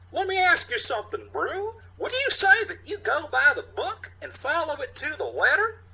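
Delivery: high, loud sneering voice; mocking high character voice. Youth needling the stern cop, in a high mocking voice.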